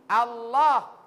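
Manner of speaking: Allah with the tongue kept in; correct Allah. Shown as a demonstration. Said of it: "Jangan tempel di gigi itu lidah". (Allah dengan lidah tidak keluar)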